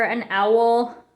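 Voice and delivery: ordinary delivery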